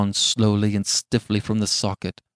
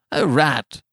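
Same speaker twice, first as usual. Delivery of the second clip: put-on slightly raised, uneasy old man's voice; a touch of tremor, pitched a little higher; mildly shaking tones; 5 semitones higher, 3 dB louder than usual. A narrator's reading. Old man explaining the knock to his wife, mildly shaking. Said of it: "said the old man in shaking tones".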